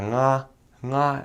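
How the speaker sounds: "Nga, nga" said haltingly, reaching for the ng sound; stumbling nga attempts. Missionary at the pulpit struggling, halting repeated nga. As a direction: imitate yourself fumbling the "Nga" sound. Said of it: "I was just like".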